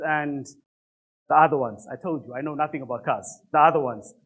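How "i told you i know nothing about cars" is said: muttered fast and much softer, lower; quiet aside. Real, not acted. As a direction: throw it away under your breath. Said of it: "aside about knowing nothing".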